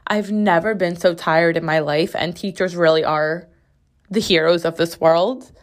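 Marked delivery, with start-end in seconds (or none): none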